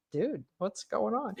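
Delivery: a spirit asking gently what's happening; Guinevere's puzzled voice. Retold or imitated. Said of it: "Guinevere's standing here and she's like".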